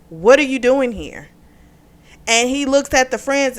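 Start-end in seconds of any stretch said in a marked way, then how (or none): 0.1-1.3 louder, higher indignant demand; imitating pissed-off husband. Husband catching his wife at the club, pissed off. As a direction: confront her, retold with real punch. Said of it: "And he's pissed".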